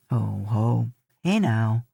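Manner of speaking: chuckling tease; low teasing cousin. A narrator's reading.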